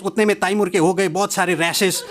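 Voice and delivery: ordinary delivery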